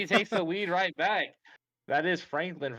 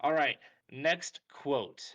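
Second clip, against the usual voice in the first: ordinary delivery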